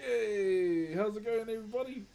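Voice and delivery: high, loud pub hail; retold cheery greeting. Man hails Irish rugby crowd in bar, retold cheer. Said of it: "and I was like".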